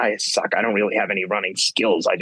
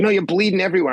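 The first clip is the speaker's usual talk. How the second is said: mock alarmed insistence; 9 semitones higher, much faster than usual. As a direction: urgently point out the blood, lightly acted. Retelling staff insisting he bleeds.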